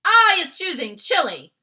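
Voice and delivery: loud, high bullying giant voice; taunting giant. Acted. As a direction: gloat like a brute. Giant insisting loudly.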